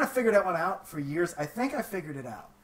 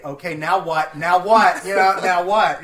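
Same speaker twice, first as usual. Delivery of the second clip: frustrated now what; 3 semitones higher, 12 dB louder than usual. Retold or imitated. Lost, frantic for directions.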